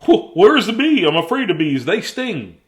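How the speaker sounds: loud, high scared moose; mild mock fright; yelled in fear. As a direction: panic about the bees. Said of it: "He yelled".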